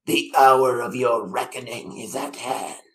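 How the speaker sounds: icy, unhurried threat from a time-traveller; cold voice. Acted. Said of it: "He says in a cold voice".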